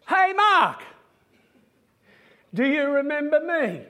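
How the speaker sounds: high, loud call across a congregation; retold shout to get the preacher's attention. Man standing up mid-service to the preacher, calling out.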